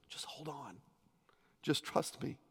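hushed, breathy whisper of comfort; soft whisper from God; whispered reassurance. Acted. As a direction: lean in and whisper it like a secret promise. God's voice quoted, comforting.

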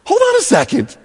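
very high, startled disbelief; indignant surprise. Retold or imitated. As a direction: protest that he was just healed. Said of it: "And this guy's like".

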